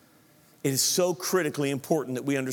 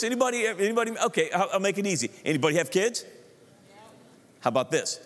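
ordinary talk

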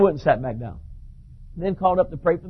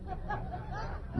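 airy high laugh; 17 dB quieter than usual. Real, not acted. After the hornet's nest line.

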